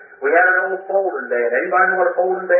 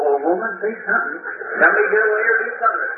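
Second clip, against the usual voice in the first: impatient inner plea; 6 semitones higher than usual. Retold or imitated.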